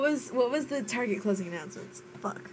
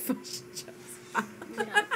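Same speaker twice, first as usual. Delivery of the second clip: laughing Kiwi fush and chups; New Zealand accent; 12 semitones higher, 6 dB quieter, slower than usual. Shown as a demonstration. Trying the Kiwi fish and chips, in a laughing New Zealand accent. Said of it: "N Z for fish and chips".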